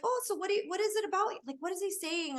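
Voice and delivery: mildly hammed-up puzzled Lola; grandma. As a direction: put on a hint of an out-of-touch old lady. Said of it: "I'm like a Lola or a grandma".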